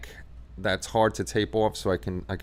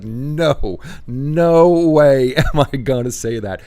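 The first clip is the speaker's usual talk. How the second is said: loud refusal; 5 semitones higher, 11 dB louder, slower than usual. Retold or imitated. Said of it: "I was like".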